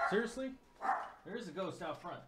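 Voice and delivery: high, hushed dog talk; soft high voice for the dogs; high pet-talk voice. Pretend. Talking to dogs, mock voice.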